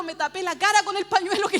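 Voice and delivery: ordinary delivery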